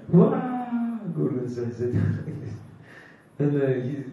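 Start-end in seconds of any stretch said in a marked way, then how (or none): 0.1-0.5 rising mock "wah", higher pitched; imitated crying wail. Guru mimics the disciple crying under Maya's lashes, mock wail. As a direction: imitate a wailing cry, pitch climbing. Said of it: "you will cry".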